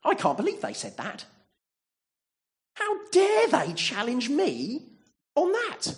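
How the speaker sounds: hammed-up hurt indignation, going shrill-high and loud; mock wounded outrage. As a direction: play the offended churchgoer, voice shooting up in hurt pride. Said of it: "Here's how I reckon I'd respond".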